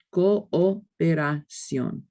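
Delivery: Cooperación said very slowly with co-o kept apart; slow syllabic demo. Shown as a demonstration. Teacher slowly modelling Cooperación for the class. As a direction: stretch out Cooperación, every vowel separate. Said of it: "These O's don't combine".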